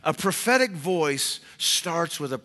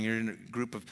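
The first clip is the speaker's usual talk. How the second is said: normal talking voice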